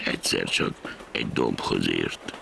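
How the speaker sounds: plain reading voice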